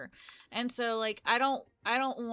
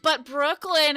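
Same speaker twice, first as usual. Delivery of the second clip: louder, higher whine; mock pleading; 5 semitones higher, 9 dB louder, slower than usual. Vlogger begging boyfriend for cute drinks, mock whining. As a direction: plead with him, half joking. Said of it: "And then I'm like".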